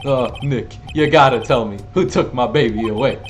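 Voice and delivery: loud, desperate plea; loud pleading. Acted. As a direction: beg him loudly for answers. Larry pleading loudly.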